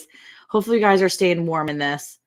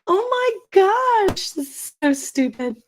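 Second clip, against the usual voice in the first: laughing exasperation; 13 semitones higher, slower than usual. Real, not acted. Laughing at broken stream.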